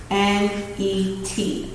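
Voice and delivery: very slow letters L E T; teacher spelling letter; spelling out slowly. Shown as a demonstration. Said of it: "spelling magnet by parts".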